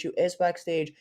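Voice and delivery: ordinary delivery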